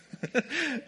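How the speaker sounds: a short, high laugh; a quick high chuckle to cover it. Real, not acted. Laughing off an unfinished insult.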